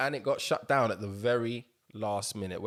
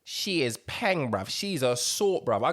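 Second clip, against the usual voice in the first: high, slow street-slang drawl; slang delivery; 3 semitones higher, 5 dB louder than usual. Shown as a demonstration. Showing off how they talk, in slang. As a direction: lay on the London slang. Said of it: "but I still say".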